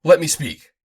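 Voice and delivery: loud, high urgent plea. Acted. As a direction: demand to be heard. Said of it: "I cried".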